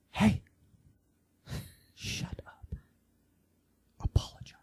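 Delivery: wife's sharp hushed whisper. Retold or imitated. A wife hissing at her husband to shut up and apologize, whispered. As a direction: whisper it fiercely under your breath. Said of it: "his wife finally goes".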